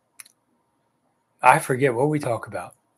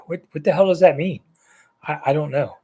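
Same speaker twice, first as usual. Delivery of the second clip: ordinary delivery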